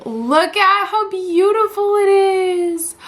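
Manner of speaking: slow swooning admiration; awed gush. Real, not acted. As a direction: coo over it lovingly. Admiring the phone.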